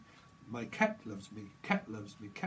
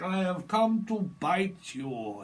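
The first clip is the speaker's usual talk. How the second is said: slow, slightly raised vampire menace; mock Dracula threat; 5 semitones higher, 5 dB louder, slower than usual. Dracula threatens to bite, slow and hammy. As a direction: threaten the bite slowly and grandly. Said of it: "I'm Count Dracula".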